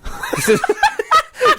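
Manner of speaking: real high laugh at his own joke. Real, not acted. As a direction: burst out laughing.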